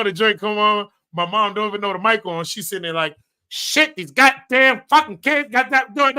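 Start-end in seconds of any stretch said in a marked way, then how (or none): 3.5-6.2 loud, higher grumbling mom; imitating mom. Son mimics his mother cursing with her mic on, loud grumble. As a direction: mom cursing, not knowing she's heard.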